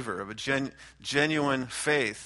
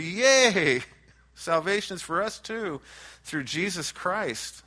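mock cheering; 4 semitones higher than usual. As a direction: play the Gentiles cheering, lightly. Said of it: "They're cheering".

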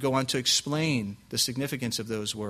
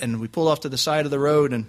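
usual speaking voice

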